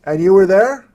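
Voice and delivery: loud, high amazed question; surprised. Real, not acted. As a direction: ask it in disbelief. Amazed host asking guest.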